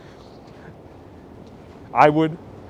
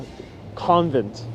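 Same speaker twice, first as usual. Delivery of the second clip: normal talking voice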